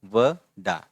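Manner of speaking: घे व डा, slow and broken into syllables. Shown as a demonstration. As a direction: sound out each syllable slowly. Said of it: "फिर ये है".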